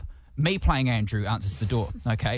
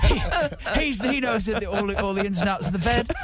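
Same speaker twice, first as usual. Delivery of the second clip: higher-pitched mock British accent; put-on British accent; 7 semitones higher than usual. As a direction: bluff it in a fake British voice. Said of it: "So I went with a British".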